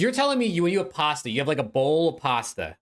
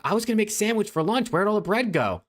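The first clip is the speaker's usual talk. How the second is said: lightly voiced parent, higher pitch; complaining parent; 8 semitones higher than usual. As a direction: imitate a puzzled parent finding the bread gone. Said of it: "they're gonna be like".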